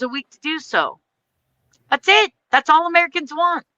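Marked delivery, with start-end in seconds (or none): 1.9-3.7 heated rant. Real, not acted.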